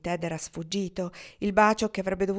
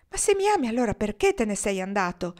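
pleading outburst; 2 semitones higher, 3 dB louder than usual. Acted. Kendra pleads, demanding an answer.

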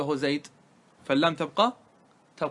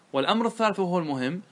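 ordinary talk, nothing marked

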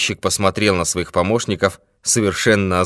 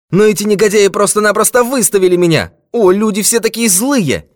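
very high, loud lament; agitated complaint; 8 dB louder than usual. A narrator's reading. Old man complaining that police threw him out, agitated. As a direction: wail in outrage.

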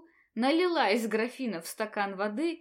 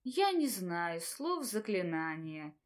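lifeless voice; 5 semitones lower, 6 dB quieter, slower than usual. A narrator's reading. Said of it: "безжизненным голосом ответила Таня".

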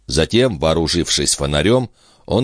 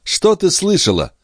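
alarmed question; 14 semitones higher than usual. Acted. Brother alarmed.

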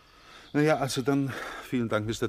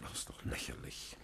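geflüstert; 14 dB quieter than usual. Acted. Franz wehrt Verdacht ab.

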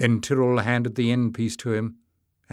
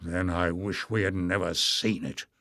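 snarled; 5 semitones lower than usual. A narrator's reading. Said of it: "snarled Barda".

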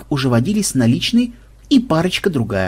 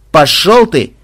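rude snap; 4 semitones higher, 6 dB louder, slower than usual. Acted. Captive tells him off.

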